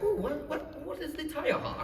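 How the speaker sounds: high, soft American woman's voice; lightly cooed American impression; imitated American. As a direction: put on a curious, high-pitched American accent. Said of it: "Ooh, American, right?".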